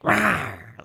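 playful mock animal rawr; imitated beast roar; injured animal roar. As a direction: growl like a hurt animal warding people off. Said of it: "like, the injured animal that's like".

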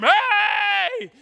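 sudden high drawn-out shout. Retold or imitated. Family calling to someone in the bathroom, sudden loud shout. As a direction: holler through a bathroom door. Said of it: "we always have to talk louder".

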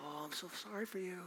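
very soft, quick murmur of pity; hushed sympathy. Acted. Churchgoer soothing someone who shares a grievance, hushed sympathy. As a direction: coo softly as if commiserating.

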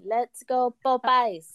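put-on slow cheer in a light French accent. Repeating the French crowd's cheer, French accent. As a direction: say it the French way, drawn out. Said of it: "I like the little French accent in there".

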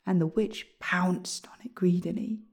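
ordinary delivery

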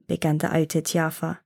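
ordinary delivery

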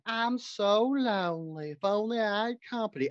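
languish it out like a lonely belle on the porch; Southern belle. Pretend. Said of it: "a Southern Belle lonely housewife".

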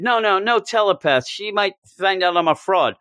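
panicked refusal, lightly done; dodging the telepath in mock panic. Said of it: "Gwenpool refusing the telepath".

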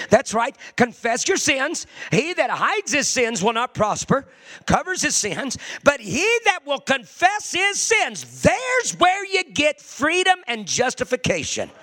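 fervent preaching. Acted. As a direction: drive the call to confession home.

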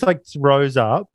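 ordinary delivery

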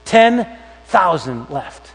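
"Ten thousand left" drawn out very slowly, each word weighted; slow emphatic repeat. Shown as a demonstration. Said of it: "repeated for emphasis".

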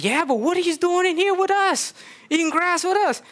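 squeaky cow asking the stranger; high cow voice. Acted. Cow asking why the man eats grass, high voice.

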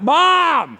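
high loud call for mom; a child's shout for mom. Retold or imitated. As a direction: call up the stairs like a crying child. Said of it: "she's calling out".

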